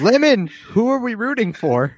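very high, squealed heckling; high-pitched voice rising to a loud shout; rowdy spectator. Acted. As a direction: egg the fighters on in a high yell. Spectator character yelling at fighters.

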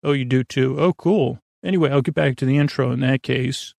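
normal talking voice

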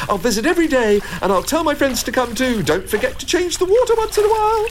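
very high chirpy bird voice; delighted sparrow. A narrator's reading. Sparrow promising Jack to visit every day, delighted. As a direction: pipe it like an eager little sparrow.

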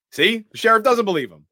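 loud, high I-told-you-so; vindicated outburst. Real, not acted. Host proves to co-host that nobody believes Loomis, loud vindication. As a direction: pounce on the proof, voice way up.

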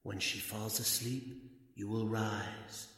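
hypnotic murmur. Acted. Hypnotist softly commanding the entranced husband, hushed. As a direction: lull him into obeying.

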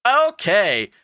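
high, loud chirp; sing-out, over-keen yes; mock eager compliance. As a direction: agree with exaggerated cheer. Acting Pink's eager reply.

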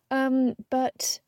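ordinary delivery

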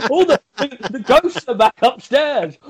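high-pitched mock announcement of ghosts; hammed-up high-pitched cry; mock alarm. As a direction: call it out high, playing it for laughs. Joking about ghosts to wife.

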